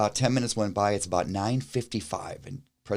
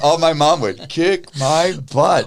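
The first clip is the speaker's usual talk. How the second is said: mock scared kid; 7 semitones higher, 9 dB louder, slower than usual. Imagined thought about mom.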